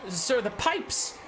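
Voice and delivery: high, slow, stuttering fright; squeaky nervous stutter; stammering fearful minion. Acted. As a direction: stammer it out, terrified. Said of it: "his commander goes".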